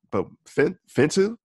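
"fin to" with a short "ih", high and questioning; accepted pronunciation. Shown as a demonstration. Southerner offering the real form, "fin to" said high as a question. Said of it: "I'm fin to do it".

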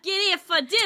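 high, mimicked Irish-sounding call; accidental Irish accent. Teasing a friend's failed London call, Irish accent. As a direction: copy a botched London accent that comes out Irish. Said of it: "you sounded like an Irish person".

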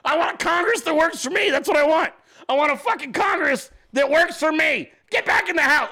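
high, loud, fast angry yelling; angry old man rant. Retold or imitated. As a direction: rant like a furious grandpa at his wife. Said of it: "And he's like".

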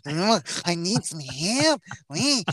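a hint of a whiny cartoon dog; Ren voice. Host drifting into a Ren and Stimpy voice, lightly voiced. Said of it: "into Ren and Stimpy voice".

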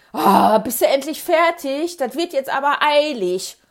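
loud, pressing complaint; urgent, exasperated father calling out; impatient urging. Acted. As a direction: raise the voice high and hurry them along. Father urgently needs the toilet.

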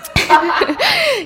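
a sudden high burst of laughter. Real, not acted.

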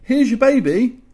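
high, loud, hurried-off farewell; breezy send-off. Acted. Handing baby back to its parents, breezy send-off. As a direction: call it out as you escape.

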